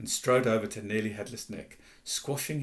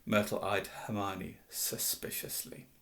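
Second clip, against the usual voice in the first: plain reading voice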